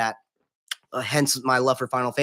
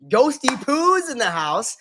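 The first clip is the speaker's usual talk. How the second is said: excited shout-out; 9 semitones higher, slower than usual. Real, not acted. Greeting a viewer joining stream.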